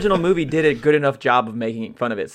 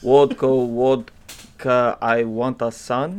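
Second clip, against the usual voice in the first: very slow, drawn-out mock Russian chant; Russian accent; much slower than usual. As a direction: stretch each vodka in a hammed-up Russian accent. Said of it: "accent heavy episode".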